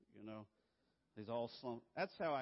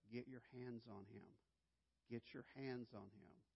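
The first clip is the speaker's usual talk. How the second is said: soft, urgent inner order; quiet self-command; 8 dB quieter than usual. Retold or imitated. Father silently ordering himself to catch son, soft urgent. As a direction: tell yourself under your breath.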